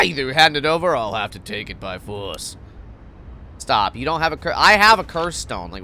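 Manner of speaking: usual speaking voice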